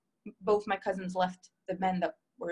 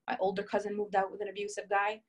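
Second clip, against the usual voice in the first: normal talking voice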